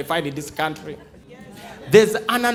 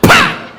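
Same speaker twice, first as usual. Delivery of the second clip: sharp, high smack sound; loud slap noise; 8 dB louder than usual. Acted.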